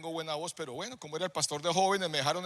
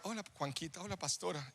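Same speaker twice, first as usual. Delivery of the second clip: hushed, polite hello; subdued greeting; 10 dB quieter than usual. Acted. Pastor's wife and youth pastor greet in the car, subdued. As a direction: greet softly, well under your breath.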